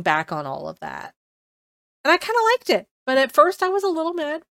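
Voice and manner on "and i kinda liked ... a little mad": high and loud, animated; lively raised voice; excited. Real, not acted. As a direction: burst out with enthusiasm. Excited about the ending.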